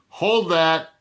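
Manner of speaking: short call to hold the elevator; loud hey-hold-that call; yelled. Retold or imitated. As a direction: shout it across the lobby. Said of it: "and I yelled".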